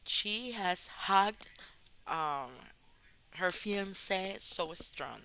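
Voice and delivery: slow, careful English example; slow example sentence. Shown as a demonstration. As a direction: say each English word slowly, for learners. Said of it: "usted puede decir". (frase de ejemplo en inglés, dicha despacio)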